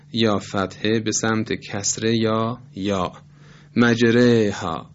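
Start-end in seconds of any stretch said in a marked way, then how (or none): 3.8-4.9 slow مجریها with the alef bent to 'ey'; imala on مجریها. Shown as a demonstration. Teacher showing why ra turns light, مجریها with imala. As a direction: say مجریها as majreyha, r kept thin. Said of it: "به خاطر این اماله حرف ر".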